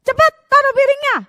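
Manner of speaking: very high, loud, fast scolding; fierce mom. Retold or imitated. Mom scolding child about the plate, loud and fast. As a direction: snap at the child like a fierce mother. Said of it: "menjadi mama yang galak".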